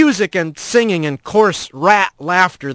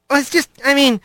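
higher, stammering backtrack; flustered; 8 semitones higher, faster than usual. A narrator's reading. Rat backtracking to Laris, flustered. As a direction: scramble for words in a higher voice. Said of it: "Rat could feel his ears blushing".

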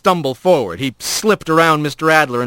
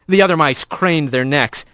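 ordinary delivery

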